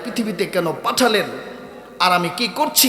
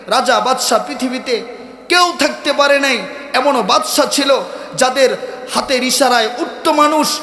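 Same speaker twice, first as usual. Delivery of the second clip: raised, punchy sermon cadence; forceful preaching; 6 semitones higher, 3 dB louder than usual. Real, not acted. Preacher recalls kings who shook the world, forceful preaching. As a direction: hammer each phrase for emphasis.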